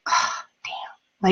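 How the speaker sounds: whispered, disappointed under the breath; a kid whispering her letdown to herself; frustrated whisper. Retold or imitated. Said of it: "Frustrated kid".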